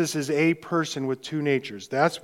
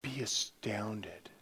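hushed awe; 5 semitones lower, 13 dB quieter, slower than usual. Acted. Preacher urges awe, hushed.

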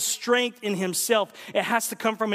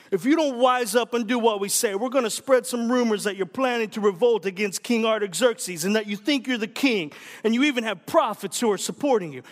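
a hint of a New York mobster accent, growing louder; mafia accent; 3 semitones higher, 3 dB louder than usual. Preacher voicing Sanballat's letter as a mobster with a light accent. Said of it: "you have to read it with an accent".

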